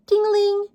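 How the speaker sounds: high ringing ting-a-ling; child imitating a bike bell, high and light; bell ding. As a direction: ring it like a little bike bell.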